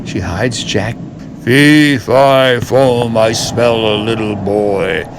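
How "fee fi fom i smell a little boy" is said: louder giant roar. Acted. Giant sniffing out Jack, roaring. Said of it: "His voice is loud as a roar".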